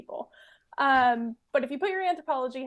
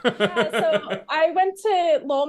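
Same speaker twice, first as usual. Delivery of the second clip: laughing; 3 semitones higher, 8 dB louder, slower than usual. Real, not acted. Laughing back at the host.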